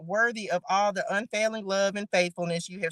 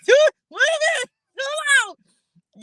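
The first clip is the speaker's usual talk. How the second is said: high, loud startled squeal; surprised squeal; 6 dB louder, slower than usual. Real, not acted. Host startled by the strange place name, high squeal. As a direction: stop short in disbelief.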